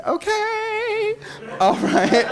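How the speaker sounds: high laugh at himself. Real, not acted. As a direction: laugh it off, a bit flustered. Laughs at not using notes.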